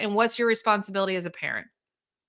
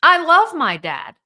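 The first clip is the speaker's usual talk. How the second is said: higher, louder, drawn-out declaration of love; emphatic child's protest; 7 semitones higher, 8 dB louder, slower than usual. Acted. Child torn between parents, emphatic insistence. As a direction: insist on it slowly, pitched up.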